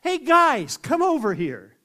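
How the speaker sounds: big, friendly summoning shout; calling out. Acted. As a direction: holler to a group to come over. Calls others over in story.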